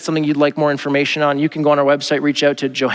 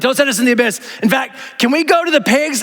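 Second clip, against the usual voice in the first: much higher, wheedling demons; pleading demons; 10 semitones higher than usual. Retold or imitated. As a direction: bargain like a nervous demon. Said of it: "they're like".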